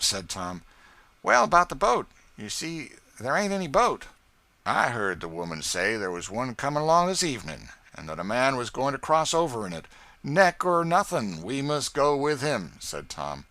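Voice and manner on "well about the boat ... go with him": higher, louder fretting and blunt reply; fret, then press on hard; nervous lawyer then gruff catcher. Acted. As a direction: Marks fretting about the boat, higher. Marks and Tom on the boat.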